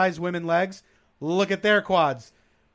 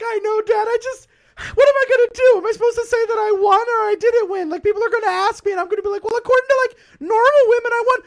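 put-on much higher, fast whiny girl voice; whiny teen daughter; 6 dB louder than usual. Teenage daughter answering her dad in a high whiny voice. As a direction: play the sulky teen girl. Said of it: "And she's like".

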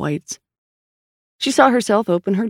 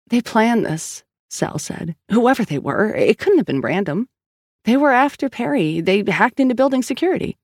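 higher, insistent frustrated woman; Sal's voice; 7 semitones higher than usual. A narrator's reading. Sal insisting to Collins and the doctor, higher and exasperated. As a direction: push through the story, annoyed. Sal argues it was planned.

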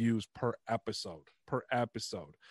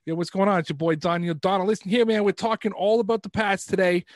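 loud, higher-pitched Boston sports-fan character; Boston accent; 8 semitones higher, 6 dB louder than usual. Acted. YouTuber's troll character greeting viewers about the Pats, loud Boston accent. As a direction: hype up the Pats in an over-the-top Boston accent. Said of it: "I legit talked in a shitty Boston accent".

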